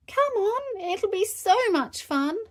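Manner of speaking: put-on high-pitched excited child; squeaky-high encouragement; eager coaxing. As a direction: coax Daddy in a high, keen voice. Peppa coaxing nervous Daddy.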